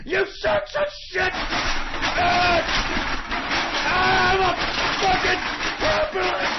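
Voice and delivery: losing it on air, shouting; furious yelling. Real, not acted. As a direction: scream curses in fury. Enraged host rant outburst.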